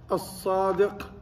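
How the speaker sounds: "الصادق" said as-sadiq, doubled heavy s; slow "الصادق" with no l sound; assimilated lam. Shown as a demonstration. Teacher adds an example of silent lam, assimilated "الصادق". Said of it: "but you don't pronounce".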